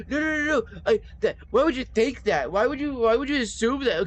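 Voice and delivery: high, loud flustered denial; defensive guy. Retold or imitated. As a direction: protest like you've been caught out. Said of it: "they're like".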